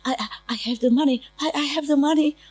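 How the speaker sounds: imitating a frightened, stammering plea, high and pitiful; begging. Retelling a homeless woman at the restaurant, begging. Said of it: "Like begging for it".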